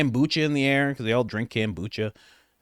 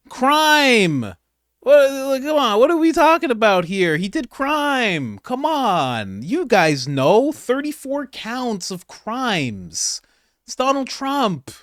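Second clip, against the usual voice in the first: high, loud mocking voice of the guest; mock outraged woman; 8 semitones higher, 4 dB louder, slower than usual. Reactor mocking the TV guest, high shrill parody. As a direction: squeal it like an outraged partisan.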